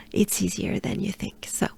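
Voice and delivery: soft reassuring whisper. Real, not acted. Teacher whispers encouragement to nervous learners. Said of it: "unvoiced aside".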